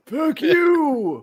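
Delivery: angry cloud. Pretend. Cloud voiced yelling at old man.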